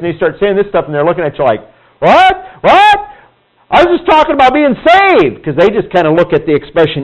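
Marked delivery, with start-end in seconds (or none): 2.0-5.4 much higher and louder, bewildered; baffled what. Retold or imitated. Confused listeners react to talk of being in Christ, high and baffled. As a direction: imitate a puzzled listener, rising.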